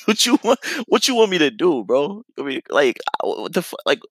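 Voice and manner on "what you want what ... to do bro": high, laughing disbelief; incredulous. Retold or imitated. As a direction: laugh then ask in a raised voice. Continued disbelief.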